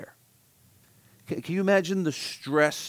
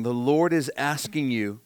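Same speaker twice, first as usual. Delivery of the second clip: ordinary talk, nothing marked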